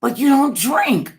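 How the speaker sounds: high, loud and slow disbelief; everybody gasping in disbelief; family protesting to the mother, high and loud; shocked protest. Retold or imitated. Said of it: "Everybody went".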